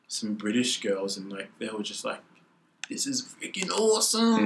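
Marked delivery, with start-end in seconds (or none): 2.9-4.5 high-pitched gushing cheer; excited partygoer. Retold or imitated. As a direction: gush like a thrilled reveller. Retelling excited British partygoers.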